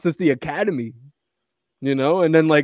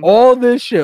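higher and louder, indignant burst; mock outrage; 8 semitones higher, 7 dB louder than usual. Retelling a guy's fury at being betrayed, mock outraged burst. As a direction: blurt it out like you've just been betrayed.

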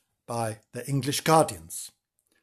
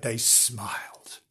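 hushed whisper; 4 semitones lower, 8 dB quieter, slower than usual. A narrator's reading. Sinister hushed storytelling.

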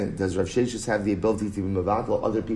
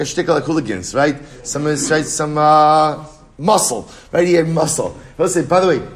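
animated and loud; 5 semitones higher, 7 dB louder than usual. Real, not acted. Teacher jokes to class about hired muscle, animated and loud. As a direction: voice rising and getting louder over the punchline.

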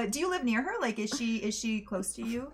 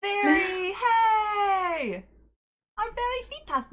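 excited greeting; 11 semitones higher, 6 dB louder, much slower than usual. Real, not acted. Greeting a guest who arrives.

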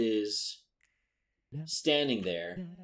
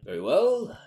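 pompous villain; 3 semitones higher than usual. Acted. Mage hunter introduces himself.

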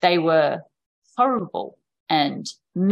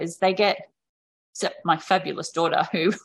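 usual speaking voice

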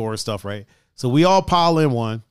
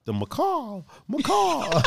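playful; 11 semitones higher, 3 dB quieter, slower than usual. Real, not acted. Savoring the bird word.

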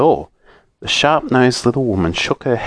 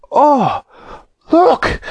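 much higher, louder, thrilled; delighted shriek; 3 dB louder than usual. A narrator's reading. Bessie spotting the cushions, delighted shriek. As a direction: squeal with delight. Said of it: "shrieked Bessie in delight".

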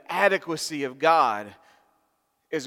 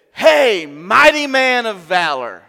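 loud proclamation; 6 semitones higher, 14 dB louder, slower than usual. Acted. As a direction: proclaim it loudly, ringing and high. Angel greeting Gideon loudly.

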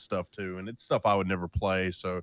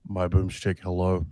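congested, husky sick voice; sick sultry voice. Real, not acted. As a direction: talk through a head cold. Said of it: "I got that sultry, sick voice".

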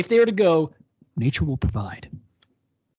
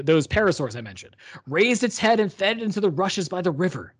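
usual speaking voice

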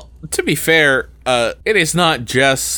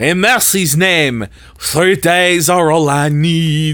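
slow, high mock French plea; French accent; 4 semitones higher, 3 dB louder, slower than usual. Valjean begging three days, slow hammed-up French accent. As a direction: plead grandly in a thick French accent. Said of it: "the French accents had me crying".

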